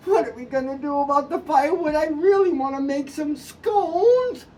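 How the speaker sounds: a hint of a fretting mom; mother's plea. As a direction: drag out the scones line. Mother repeats her plea.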